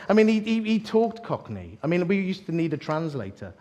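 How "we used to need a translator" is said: ordinary talk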